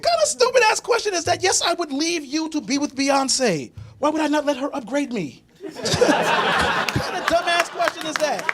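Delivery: high, mocking disbelief; incredulous. Comedian scoffs at his girlfriend's question, high mocking disbelief.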